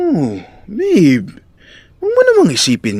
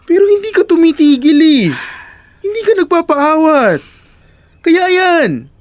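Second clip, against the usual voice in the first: pitched-up exasperated accusation; heated reproach; 8 semitones higher than usual. Acted. Boyfriend blaming her for not stopping, heated reproach. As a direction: throw it back at the partner, worked up.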